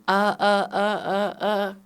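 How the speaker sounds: loud, repeated "uh" like a remix; stuttered filler uhs. Retold or imitated. Host mimicking comics' filler words, loud stuttering uhs. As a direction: chop out a string of uhs. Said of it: "are you doing a remix".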